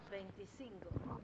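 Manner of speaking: a hushed, slow aside prompting the co-host; whispered prompt. Real, not acted. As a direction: whisper the number off mic.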